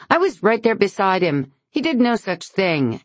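high, loud denial; indignant. A narrator's reading. Lauren defending Ian against Beverly, high and loud. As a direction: defend him hotly.

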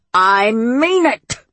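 the witch hollering at the swamp, slow and insistent. A narrator's reading. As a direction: stretch each word out as a warning. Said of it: "she hollered".

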